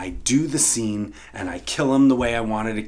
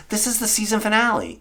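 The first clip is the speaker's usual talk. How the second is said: pleading; 11 semitones higher, faster than usual. Retold or imitated. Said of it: "I said".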